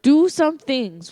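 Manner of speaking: high, loud and drawn out; loud announcer repeat. Pretend. Host relaunches the interview after banter, loud drawn-out announcing. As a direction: belt it out slowly to restart the show. Repeats it as big show restart.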